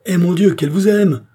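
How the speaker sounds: high, loud exclamation; passionate outburst. A narrator's reading. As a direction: burst out with the accusation. Queen's jealous outburst to Charny.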